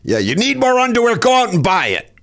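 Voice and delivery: high mocking mother voice; mother's voice. Mother mocking her child's request, high sarcastic voice.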